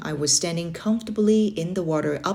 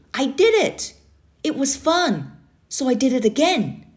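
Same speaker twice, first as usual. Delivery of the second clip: higher, thrilled telling; excited; 8 semitones higher than usual. A narrator's reading. Boy thrilled he dunked his head. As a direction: bubble over with pride. Triumph after dunking.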